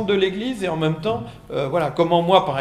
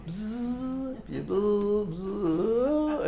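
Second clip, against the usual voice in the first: electric buzz; 6 semitones higher, 5 dB quieter, much slower than usual. Retold or imitated. Mimics an electric car charging.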